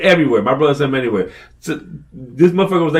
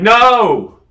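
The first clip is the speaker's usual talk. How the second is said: raised-voice retold telling-off; yelling at the kid; 7 semitones higher, slower than usual. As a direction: yell like a dad scolding his son. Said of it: "I'm yelling at the kid like he's my fucking son".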